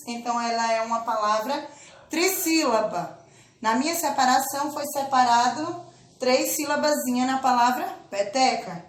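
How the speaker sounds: ordinary talk, nothing marked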